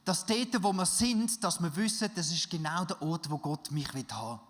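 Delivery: laut und hoch, eindringlich predigen; Predigt mit voller Kraft. Acted. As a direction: mit Feuer zur Gemeinde predigen. Preaches to the congregation. (laut predigend, der Prediger ruft die Gemeinde auf)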